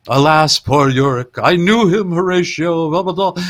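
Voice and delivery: lightly voiced theatrical Hamlet; hammy Hamlet. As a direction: declaim like a ham actor doing Hamlet. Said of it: "he starts doing Hamlet".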